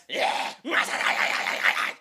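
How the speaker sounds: high airy goblin shriek; goblin high scream. Shown as a demonstration. Coach demos the singer's goblin-like high scream. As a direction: let out a high goblin screech. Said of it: "And those highs".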